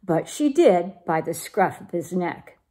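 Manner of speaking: usual speaking voice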